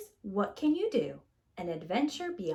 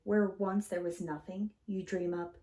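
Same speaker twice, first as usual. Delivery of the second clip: put-on gentle read-aloud to children; storybook reading; 2 semitones higher, 4 dB louder than usual. A narrator's reading. Teacher reading a picture book to kids, gentle and lively. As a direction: read it warmly, like story time.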